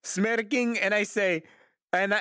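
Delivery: ordinary delivery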